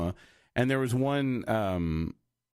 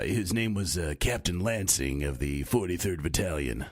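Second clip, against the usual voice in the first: deep, haggard military voice; haggard old captain; 5 semitones lower than usual. Shown as a demonstration. Old captain in a radio play, haggard and deep. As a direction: drop low like a worn-out old officer. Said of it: "more haggard and deeper".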